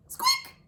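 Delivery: a very high, soft mouse squeak. Acted.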